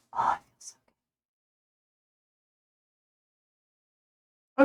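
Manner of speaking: unvoiced sigh of relief; whispered relief. Real, not acted. Streamer scratching an itchy fuzz, whispered relief.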